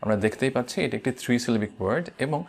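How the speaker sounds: usual speaking voice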